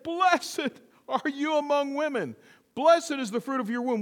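high, ringing quote of Elizabeth; loud proclamation. Retold or imitated. Preacher voices Elizabeth blessing Mary, loud proclamation. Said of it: "spoke out with a loud voice and said".

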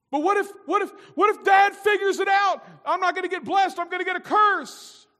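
high, loud frantic shout; panicked Jacob. Acted. Jacob panicking to his mother about being cursed, high shouted. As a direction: cry out in fear of the curse. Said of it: "You know".

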